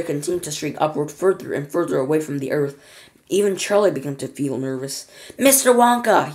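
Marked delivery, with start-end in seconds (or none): 5.4-6.3 high, loud call; urgent raised shout for attention; yelling over noise. A narrator's reading. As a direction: call out above a roar. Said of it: "he yelled above the noise".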